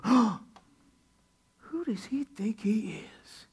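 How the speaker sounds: slow, breathy shocked whisper. Acted. The Pharisees whisper among themselves, slow and scandalized. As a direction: hiss it out in disbelief.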